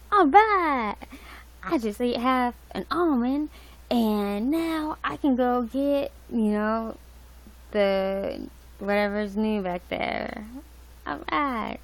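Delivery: thrilled over tiny things; Target lady impression. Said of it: "She's like".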